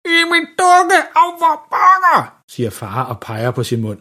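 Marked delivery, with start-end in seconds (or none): 0.0-2.4 high, garbled cry with a burnt tongue; brændt tunge. A narrator's reading. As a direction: wail it with a useless tongue. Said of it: "Det er lidt svært at forstå, hvad han siger". (forpint, utydelig råben med brændt tunge)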